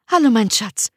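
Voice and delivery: hoch und laut gerufene Begrüßung der Frau; Ehefrau. Acted. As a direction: die Frau ruft ihren Schatz von hinten. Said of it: "hörte ich meine Frau hinter mir". (Stimme der Ehefrau)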